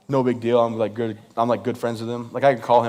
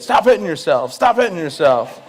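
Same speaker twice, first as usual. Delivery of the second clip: higher, louder playground taunt; taunting wife; 4 semitones higher, 5 dB louder than usual. Retold or imitated. Imitating wife hitting him with his own arm, loud taunt. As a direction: jeer it, dragging out the repeat.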